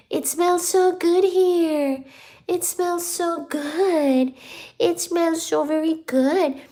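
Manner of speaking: high, slow cooing. Real, not acted. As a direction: coo it slowly up high. Cooing to the cat.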